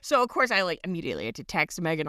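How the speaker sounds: normal talking voice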